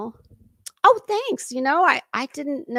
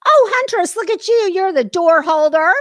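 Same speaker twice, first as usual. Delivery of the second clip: high, loud delighted hello to a chatter; light up as you spot a viewer; excited greeting; 7 semitones higher, 10 dB louder than usual. Real, not acted. Streamer greets Huntress in chat, thrilled and loud.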